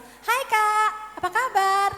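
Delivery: bright, high-pitched hello; friendly greeting. Acted. Counselor saying hello to a troubled pupil, friendly greeting. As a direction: greet the child in a lifted, chirpy pitch.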